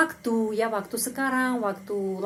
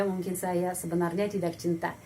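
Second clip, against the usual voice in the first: ordinary talk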